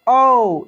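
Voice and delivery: ओ said with force; emphatic ओ; loud ओ. Shown as a demonstration. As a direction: punch ओ. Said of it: "जोर लगा के बोलना है".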